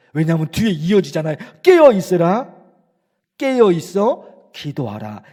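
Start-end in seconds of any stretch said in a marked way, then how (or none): 1.6-5.2 Jesus urging. Acted. Jesus in Gethsemane urging disciples, loud then softening. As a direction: press the command, then let it drop. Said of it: "예수님 그러셨거든요".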